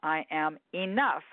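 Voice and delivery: enough stressed with a falling tune; I am enough landing hard on enough. Shown as a demonstration. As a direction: lean on the last word enough. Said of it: "put the emphasis on all three of those words".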